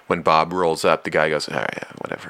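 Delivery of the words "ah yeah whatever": low, soft dismissive mumble; imitating a guy going quiet; quiet brush-off. Guy brushing off Bob in a crowd, low soft mumble. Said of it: "and he gets quiet".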